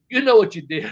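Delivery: high, loud imitation of a boss's rebuke; retold accusation. Boss to wrestler who is playing dumb, loud mock accusation.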